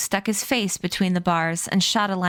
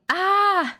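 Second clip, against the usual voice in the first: high startled scream; boy terrified of a ghost, screaming; 12 semitones higher than usual. A narrator's reading. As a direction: shriek in sudden fright. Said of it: "the boy screamed".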